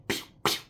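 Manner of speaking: voiceless popping noise; whispered pew pops. Retold or imitated. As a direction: mimic stitches popping. Strings popping in shoes.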